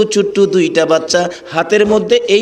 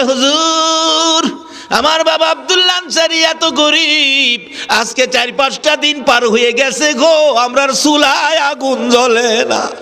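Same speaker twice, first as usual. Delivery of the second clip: high, drawn-out half-chanted plea; plaintive lament; 9 semitones higher, slower than usual. Acted. Starving children tell the Prophet of their poverty, plaintive chant. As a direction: wail it out high, stretching the line ends.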